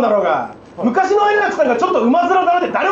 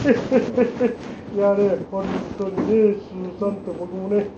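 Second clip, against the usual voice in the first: low, soft and slow, a hint of the actor's murmur; lightly voiced Nishida, hushed and drawn out; Nishida Toshiyuki impression; 6 semitones lower, 7 dB quieter, much slower than usual. Comic introducing his Nishida Toshiyuki impression, low and soft. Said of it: "続きまして、西田敏行。".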